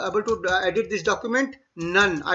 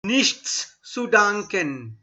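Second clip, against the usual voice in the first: slow Nichts zu danken, every sound; careful pronunciation demo; slow model phrase; slower than usual. Shown as a demonstration. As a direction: spell it out for the class. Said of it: "Try and pronounce every alphabet".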